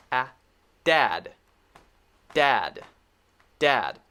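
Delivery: dead, did, deed, dud, dad one at a time; slow careful vowel drill from dead to dad; very clear vowels. Shown as a demonstration. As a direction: isolate each word with long pauses, vowels distinct. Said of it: "you say them very, very clearly".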